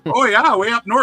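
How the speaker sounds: high, singsong Yooper; Yooper accent. Pretend. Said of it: "I only speak Yooper".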